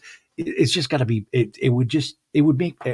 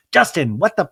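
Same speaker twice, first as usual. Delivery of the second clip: put-on sharp, louder, no baby voice; exasperated outburst; 7 semitones higher, 6 dB louder than usual. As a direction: drop the sweetness and snap. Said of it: "she even drops the baby voice".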